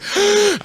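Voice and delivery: sharp high gasp for breath; gasp for air. Retold or imitated. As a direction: gasp like you've been winded. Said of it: "I'm sitting gasping for air".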